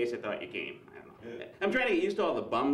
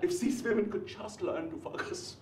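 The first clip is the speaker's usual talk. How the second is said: higher, thick Austrian accent impression; hammed-up Austrian governor; heavy Austrian accent, mangled vowels; Arnold; 6 semitones higher than usual. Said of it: "the way he says the word focus".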